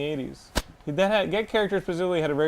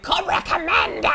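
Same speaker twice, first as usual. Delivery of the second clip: high, slow villain screech; Cobra Commander voice; 10 semitones higher, slower than usual. Retold or imitated. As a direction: shriek it like a cartoon villain. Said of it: "the same dude who did".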